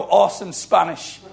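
ordinary delivery

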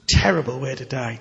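ordinary talk, nothing marked

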